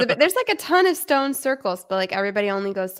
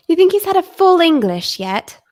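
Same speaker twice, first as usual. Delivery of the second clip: lightly put-on British accent, raised and loud; playful British accent; 7 semitones higher, 7 dB louder than usual. Co-host joining the game about a friend in London, British accent. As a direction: pose it in a mock-British voice. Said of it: "Can we play it in British accents?".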